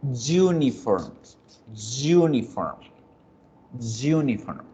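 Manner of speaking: "uniform" empezando con sonido de Y, como en yuca; pronunciación real. Shown as a demonstration. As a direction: di "uniform" con Y consonántica inicial, aislado y claro. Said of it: "Realmente se pronuncia". (modelo de pronunciación consonántica)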